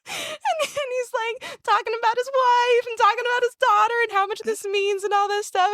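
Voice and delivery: very high, tearful squeak; trying not to cry. Real, not acted. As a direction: push the story out through tears. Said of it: "trying not to cry".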